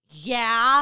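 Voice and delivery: stern warning yeah. Retold or imitated. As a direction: dare the kid to say "Yeah?" again. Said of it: "my dad would be like".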